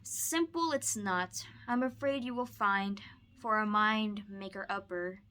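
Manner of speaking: lively, expressive storybook read-aloud; bouncy rhyming picture-book voice; reading to children. Acted. Teacher reads Seuss to student.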